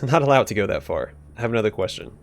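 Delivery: ordinary delivery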